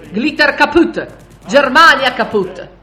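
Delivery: high, slow, very loud shout; shrieking farewell. A narrator's reading. Russian soldier takes his leave, yelling about Germany's defeat. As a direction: yell it out in triumph, word by word. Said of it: "Si congedò strillando".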